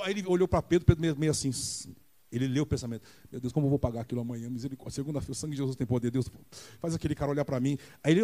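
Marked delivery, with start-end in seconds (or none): 3.3-7.8 fast worried mutter. Acted. Peter's anxious inner thoughts.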